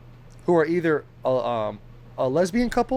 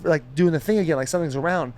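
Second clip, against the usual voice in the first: ordinary delivery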